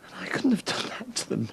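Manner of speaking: high, whispered, strained confession; a killer whispering why he stayed silent; breaking whisper. Real, not acted. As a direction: voice gives way to a whisper. Confessing the killing.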